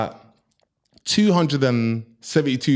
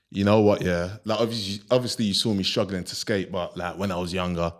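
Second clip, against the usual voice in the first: put-on deep voice, lower and softer; 7 semitones lower, 6 dB quieter than usual. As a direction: drop the voice low to sound cool. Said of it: "I had to put a deep voice on".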